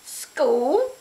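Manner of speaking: high, loud scornful shout. Acted. Witch shouting in scorn, high and loud. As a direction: spit the word back. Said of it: "shouted Grizz".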